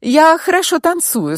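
high, hesitant answer with false starts; tentative, girlish-high self-promotion; slightly unsure. A narrator's reading. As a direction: grope for words, pitched well up. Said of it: "чуть неуверенно улыбнулась".

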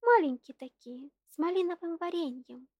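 put-on high, bright child voice; eager little girl. A narrator's reading.

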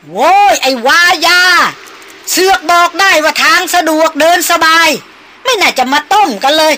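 loud, high, furious yelling over the storm; angry shouting. A narrator's reading. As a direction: bellow it like an old man cursing the storm. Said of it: "เสียงตาเฒ่าบุญคำแหกปากตะโกนลั่นออกมา".

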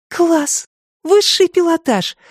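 high, delighted exclamation; admiring. A narrator's reading. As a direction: gasp in admiration. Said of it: "восхищённо выдохнула Анька". (восхищённо)

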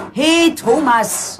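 long, loud call, slowed right down. Acted. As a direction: call him loudly and draw it out. Mother calls son in dark shed. (langgezogener, lauter Ruf)

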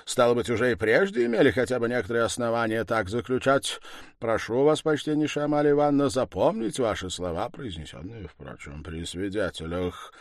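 brisk and emphatic, dropping to an aside; formal accuser. Acted. Accuser asking landlady to remember her words, formal and pointed.

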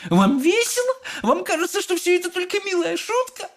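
very high-pitched outraged questions; shrieking. A narrator's reading. Mother with umbrella aimed at Wonka, shrieking. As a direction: screech it indignantly. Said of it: "визжала она".

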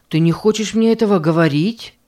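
ordinary delivery, nothing marked

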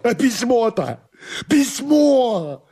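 very high tearful wail; desperate cry. Acted. Master demands the letter from servant, desperate high cry. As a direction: cry it out in high-pitched panic.

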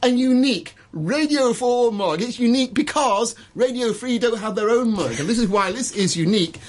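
high, bright auction-room announcer; auctioneer patter. Shown as a demonstration. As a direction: sell the mug to the whole room. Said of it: "should we auction something".

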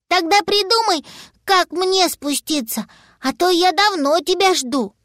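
put-on high, childlike character voice; little dragon. Stranded little dragon to his papa, high-voiced request. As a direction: demand help like a little one.

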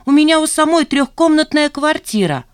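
high, loud indignant retort; exasperated. A narrator's reading. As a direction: reject the accusation hotly. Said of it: "в сердцах ответила Жанна". (возмущённо, в сердцах)

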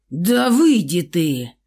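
slow loud angry bark; barked order. Acted. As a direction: bark it out, furious. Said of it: "рявкнул Михаил".

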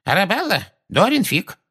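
very high, timid quaver; trembling voice. A narrator's reading. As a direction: answer nervously in a thin high voice. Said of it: "сказала миссис Фигг дрожащим голосом".

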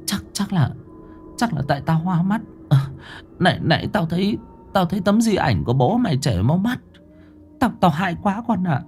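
shaken stammer. Acted.

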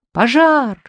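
high, loud panic cry; frantic shout. Acted. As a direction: scream it out in terror. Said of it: "закричал я не своим голосом".

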